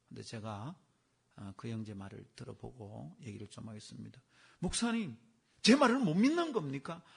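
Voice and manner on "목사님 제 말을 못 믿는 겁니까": higher, louder indignant protest; retold angry protest. Deacon confronting his pastor, higher and louder in indignation. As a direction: play the offended deacon demanding to be believed. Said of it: "화를 내는 거예요".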